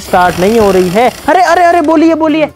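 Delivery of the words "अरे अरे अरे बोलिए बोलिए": higher, louder startled exclamations; sudden excitement. Real, not acted. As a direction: burst out excitedly at the first sign of life. Bike suddenly gives a jolt.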